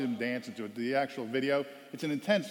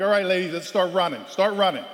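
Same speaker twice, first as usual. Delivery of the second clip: louder barked order; coach command; 5 semitones higher, 7 dB louder than usual. Retold or imitated. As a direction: send them off running. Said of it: "and I'm like".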